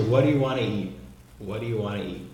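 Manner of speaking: "What do you wanna eat" as one run-on phrase; linked casual speech. Shown as a demonstration. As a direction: model "waddayu wanna ee" for repeating. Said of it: "Vamos, vamos repetir".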